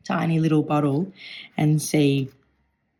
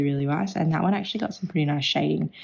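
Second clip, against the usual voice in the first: ordinary talk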